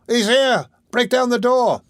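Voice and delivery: high, loud frantic yell; boy shrieking. A narrator's reading. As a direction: scream it down to the crowd below. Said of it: "shrieked the boy".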